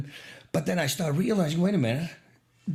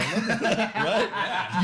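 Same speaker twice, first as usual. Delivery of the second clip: higher, with real laughter; laughing; 6 semitones higher, much slower than usual. Real, not acted. To the host, delighted with his own story, laughing. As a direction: laugh as you say it.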